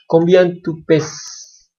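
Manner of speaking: very slow, each syllable laid out for learners; slow model reading. Shown as a demonstration. Teacher slowly models French question for students. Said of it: "teacher models the French slowly".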